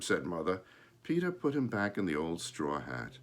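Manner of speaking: plain reading voice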